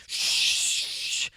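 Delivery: breathy movie-hero shush; whispered shush. Retold or imitated. Movie guy with finger on her lip, whispered shush. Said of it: "the movie thing where ... he goes".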